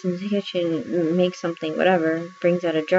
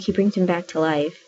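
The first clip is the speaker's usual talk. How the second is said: ordinary talk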